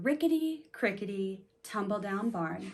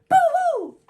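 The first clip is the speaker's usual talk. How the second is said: high loud boo-hoo wail; rat crying; 12 dB louder than usual. Acted. The rat cries after the flea's sneeze, loud high wail. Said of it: "who cried".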